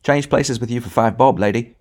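put-on a wide boy tempting the woman; cheeky bargain. A narrator's reading. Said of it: "said someone else".

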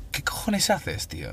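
harsh whisper, no voice at all; whispered retort. Retold or imitated. Soaked by a friend's prank, he whispers what are you doing. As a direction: hiss it under your breath, shocked. Retold reaction to being soaked.

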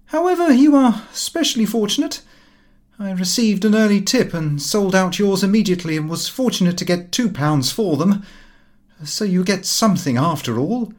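lively son's voice, pitched high and fast; a brash young man breaking bad news breezily. A narrator's reading. Son telling father the shares collapsed, brash and high.

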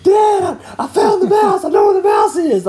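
put-on high-pitched, breathless child's shout; excited kid. Daughter bursting out of the bathroom, shrieking with excitement. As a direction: squeal it like an excited little girl.

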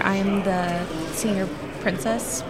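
ordinary talk

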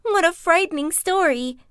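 frightened Piglet. Acted. Piglet scared.

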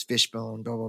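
ordinary delivery

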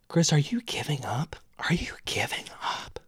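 unvoiced whisper to himself; a voice in his head asks if he gives up. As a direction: whisper it like an inner voice.

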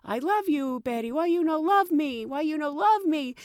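lightly voiced whining driver; imitating taxi driver. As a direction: beg in broken English. Voicing the driver.

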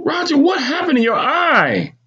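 very high, demanding question; insistent mother. A narrator's reading. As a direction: press the boy for an answer, pitched high. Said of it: "My mother asked again".

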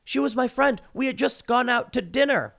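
high-pitched mock protest, lightly acted; imitating Alec Baldwin. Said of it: "he was talking about how".

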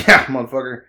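a loud, teasing bark of a jeer; loud mock jeer. Welder teasing the ex-Facebook new guy, loud mock jeer. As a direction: razz him loudly, all in fun. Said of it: "We were just talking shit".

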